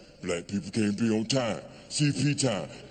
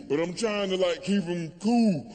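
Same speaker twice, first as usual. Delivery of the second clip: usual speaking voice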